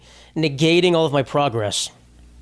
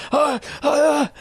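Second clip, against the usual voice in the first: string of very high pained yelps; retold pain yelps; 12 semitones higher, slower than usual. Vlogger reliving long burn, very high yelps. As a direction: squeal like a finger on hot metal.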